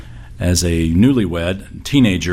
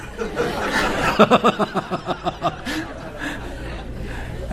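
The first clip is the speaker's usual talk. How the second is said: real laugh; 13 semitones higher, 7 dB quieter than usual. Real, not acted. Preacher laughs at grandma's answer.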